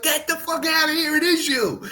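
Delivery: high, amazed squeal of disbelief; blown away. Retold or imitated. Sabu recognising an old friend, shrieking high in amazed disbelief. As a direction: squeal it like you can't believe your eyes. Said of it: "He was like, blown away".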